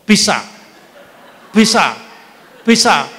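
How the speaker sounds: Bisa said loud and punchy; punned emphasis. Shown as a demonstration. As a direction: hit each Bisa hard and falling. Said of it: "Gigit orang sampai mati, bisa".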